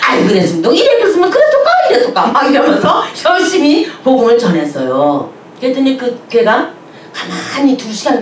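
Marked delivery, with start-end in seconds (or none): 0.0-2.3 rising sharply, Hamgyong North Korean lilt; Hamgyong dialect. Retold or imitated. Preacher mimicking dialect to win guide, rising high. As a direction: climb up high in Hamgyong speech. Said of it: "이래가면서".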